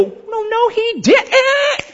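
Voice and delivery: high, squeaky mock disbelief; hammed-up outraged gasp; indignant rabbis. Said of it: "see the rabbis go".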